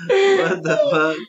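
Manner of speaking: whiny, complaining squeal. Real, not acted. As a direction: whine it out like a brat. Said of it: "Was that my whiny bitch voice?".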